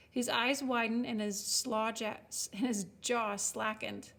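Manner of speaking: usual speaking voice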